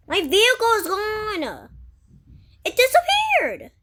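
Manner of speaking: high, loud cry of alarm; shocked shout. Real, not acted. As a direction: shriek it in panic. Car vanishes in game.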